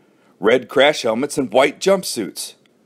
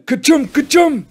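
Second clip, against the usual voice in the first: two high, loud play-acted sneezes; mock double sneeze; 14 semitones higher, 5 dB louder than usual. A narrator's reading. Joe pretending to be Billy on peppered worm, mock sneezes. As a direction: sneeze twice, theatrically. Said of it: "Joe sneezing".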